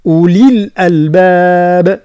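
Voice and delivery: أولي الألباب recited slowly, linked together; connected recitation. Shown as a demonstration. Teacher models recitation without a pause. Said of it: "كي نواصلوا تولي".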